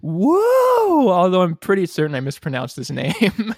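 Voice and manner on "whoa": high, loud game-show whoa; big surprised whoa; excited whoa. Real, not acted. Host announces correct answer.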